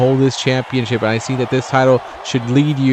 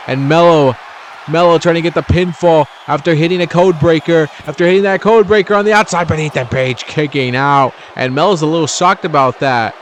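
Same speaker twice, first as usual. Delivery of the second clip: loud, higher-pitched match call; excited play-by-play; 4 semitones higher, 4 dB louder than usual. Real, not acted. Commentator calls a code breaker and kick out, excited. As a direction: call the near fall at full excitement.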